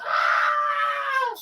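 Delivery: very high, sustained mock scream; imitated scream. Retelling the woman being sprayed again, imitated scream. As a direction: shriek long and high in pretend horror. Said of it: "just screaming".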